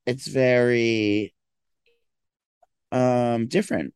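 stretched, groggy pause before different; slow drawn-out very. Real, not acted. On the phone to a friend, slow and groggy. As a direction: drag it out sleepily. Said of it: "you're talking like a weirdo".

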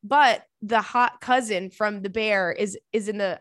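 normal talking voice

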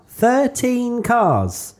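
slow, high mock awe; drawn out with fake amazement; sarcastic. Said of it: "If you can't tell, that's sarcasm".

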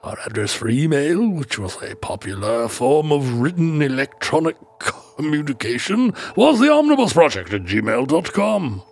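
hammed-up British toff with swooping pitch; monocled British accent. Host reading social media sign-off, exaggerated British accent. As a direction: declaim it in an overdone British accent. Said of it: "he grew a monocle".

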